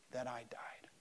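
soft, low near-whisper; solemn, breath-only close to a quote; hushed. Retold or imitated. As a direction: let it drop almost to a whisper. Solemn climax of Jesus' quote.

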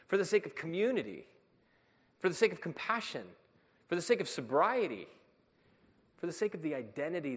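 loud, rising preacher cadence, each phrase hammered; fervent sermon peak; preaching at full force. Acted. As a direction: build it like a pulpit crescendo. Preaching climax, repeated phrases.

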